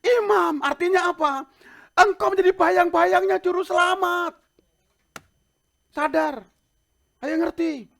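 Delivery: high, loud, ringing preacher's delivery; preaching at full force. Acted. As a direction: drive the charge home, loud and high.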